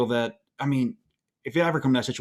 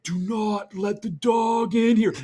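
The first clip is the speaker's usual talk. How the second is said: high, slow, drawn-out yell; retold yelling; 9 semitones higher, 4 dB louder, much slower than usual. Husband re-enacts yelling at wife about the dog, urgent yell. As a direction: holler each word as an urgent order. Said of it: "heard me yelling at my wife going".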